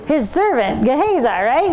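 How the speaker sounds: ordinary delivery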